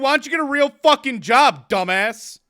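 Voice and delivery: loud, high furious yell; heated yell. Retold or imitated. Yelling at the delivery driver, loud and furious. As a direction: blow up at the driver. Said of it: "I got heated".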